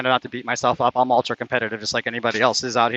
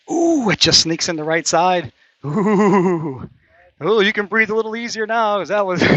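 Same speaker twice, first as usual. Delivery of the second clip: excited ooh; 8 semitones higher, 5 dB louder, slower than usual. Real, not acted. Watching a putt drop.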